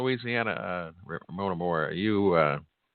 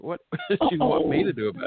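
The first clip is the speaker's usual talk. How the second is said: laughing. Retold or imitated. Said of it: "I'm like".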